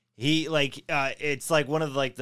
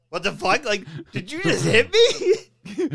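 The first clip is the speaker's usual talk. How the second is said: high-pitched mock disbelief, half laughing; stunned kangaroo; 7 semitones higher, 4 dB louder, slower than usual. Podcaster voicing a kangaroo that got punched, stunned and high. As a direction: act out an incredulous kangaroo. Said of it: "you see the kangaroo just like".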